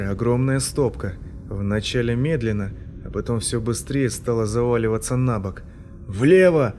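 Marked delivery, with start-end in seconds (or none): 6.1-6.7 loud, higher yell; shout. A narrator's reading. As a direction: bellow the direction. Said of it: "заорал Полнов".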